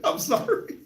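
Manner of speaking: slow words squeezed out through a laugh; real giggling. Real, not acted. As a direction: laugh helplessly while talking. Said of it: "all I can do is laugh".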